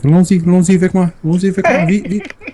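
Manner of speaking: high, fast playful French. Retold or imitated. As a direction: hurry them along in French. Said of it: "I was like".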